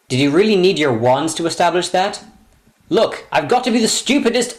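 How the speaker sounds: normal talking voice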